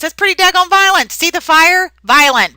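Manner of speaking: teacher loudly marveling at a violent photo; excited outburst. Real, not acted. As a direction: blurt it out in shock at the picture. Teacher exclaims at fire photo.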